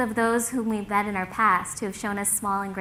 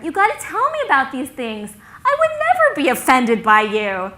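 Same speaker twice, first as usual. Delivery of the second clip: higher, emphatic reassurance; earnest insistence; 4 semitones higher, 6 dB louder than usual. Retold or imitated. Logan assuring his friend he is not offended, earnest insistence. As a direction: insist with growing volume.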